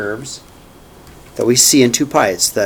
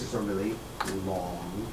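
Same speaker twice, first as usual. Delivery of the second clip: really low voice; 7 semitones lower, 15 dB quieter than usual. Shown as a demonstration. Teacher shows his class a low voice, low and soft. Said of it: "If I talk in a really low voice".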